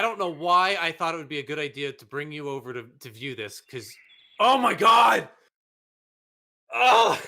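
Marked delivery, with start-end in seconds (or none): 4.4-7.2 loud, higher exasperated yell; real shout. Real, not acted. Gamer snapping at the roulette puzzle, real shout. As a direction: let it burst out of you.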